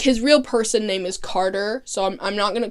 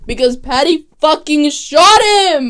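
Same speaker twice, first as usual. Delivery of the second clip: louder, higher, aghast; shocked outburst; 5 semitones higher, 8 dB louder than usual. Real, not acted. Narrator reacting to the shooting, loud and shocked. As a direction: blurt it out in disbelief.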